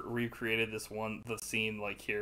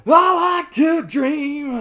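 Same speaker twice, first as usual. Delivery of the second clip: dreamy mock voice; 14 dB louder, much slower than usual. Mocking Cochrane in the ride scene.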